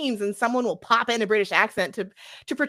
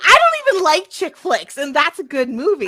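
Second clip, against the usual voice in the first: high, loud burst of indignant enthusiasm; excited outburst; 10 semitones higher, 9 dB louder than usual. Real, not acted. Fan interrupts to defend The Holiday, loud and excited. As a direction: leap in loudly to defend it. Loudly defending a film she loves.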